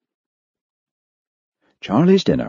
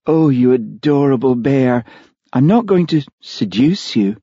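high, playful lady's voice; teasing reassurance; 7 semitones higher, 3 dB louder than usual. Acted. Olivia to blushing Charlie, teasing reassurance. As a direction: coo at a shy admirer. Guest teases her shy host.

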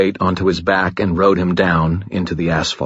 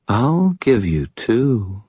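high, slow, seductive woman's voice; alluring murmur; 4 semitones higher, much slower than usual. A narrator's reading. Woman with two guns behind him, alluring murmur. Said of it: "maddeningly alluring voice murmured".

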